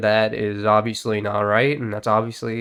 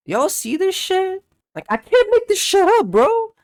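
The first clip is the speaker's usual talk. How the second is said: much higher and louder, yelling in disbelief; outraged shout; 7 dB louder than usual. Real, not acted. Vlogger yelling at viewers about the tweet, outraged shout. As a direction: blow up at the audience.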